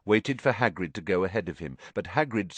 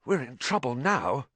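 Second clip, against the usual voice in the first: hoarse, raised, worried voice; 7 semitones higher than usual. A narrator's reading. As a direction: croak out the worry. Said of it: "he said hoarsely".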